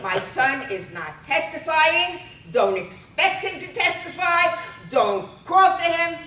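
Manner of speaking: high, loud, emphatic mother; indignant protective outburst; defiant refusal. Acted. Mother defies the cops.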